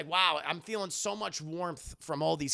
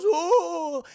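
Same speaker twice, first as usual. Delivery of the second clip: loud, high, drawn-out "ooh"; mock amazed "oh"; 12 semitones higher, 11 dB louder than usual. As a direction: imitate an astonished cry of discovery. Acting out character spotting structures.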